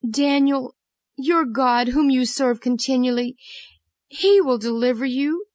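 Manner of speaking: a hint of a king calling to his servant; lightly voiced king. A narrator's reading. King's last words to Daniel.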